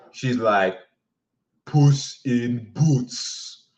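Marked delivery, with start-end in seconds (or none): 1.6-3.7 put-on slow, dreamy drunk girl voice; lightly voiced drunk girl. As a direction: drawl it out like a tipsy girl.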